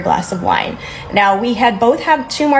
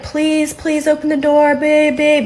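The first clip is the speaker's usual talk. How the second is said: high, pleading mock sob; imitating his crying through the door; crying plea; 7 semitones higher, slower than usual. To her through the locked door, a high crying plea. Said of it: "like, crying".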